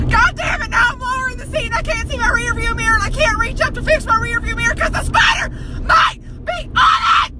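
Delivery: high, hurried, distressed complaint; panicked ranting. Real, not acted. Driver sunk in the seat fearing the spider, panicked. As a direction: rattle it out in frightened exasperation.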